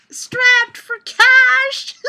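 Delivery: high, loud, slow squeal of glee; delighted shout. Real, not acted. Vlogger shrieking her own pun back in delight. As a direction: crow the pun back, thrilled. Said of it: "What a horrible pun".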